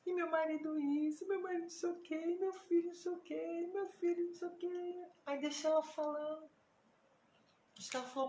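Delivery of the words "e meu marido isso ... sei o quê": high, sing-along list of woes; mock weepy litany, pitched up; imitated tearful complaining. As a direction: mimic a woman wailing on and on. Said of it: "e chorava".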